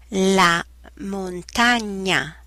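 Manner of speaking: "la montagna" slow and careful; slow textbook pronunciation. Shown as a demonstration. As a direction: stretch "montagna" for learners.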